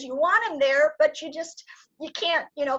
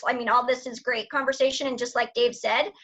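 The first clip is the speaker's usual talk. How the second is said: ordinary delivery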